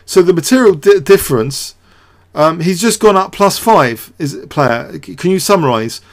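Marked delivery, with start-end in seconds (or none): none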